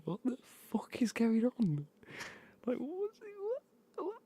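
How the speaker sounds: high-pitched, incredulous retold reaction; baffled. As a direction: voice leaps up in hammed-up bewilderment. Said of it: "I'm so confused".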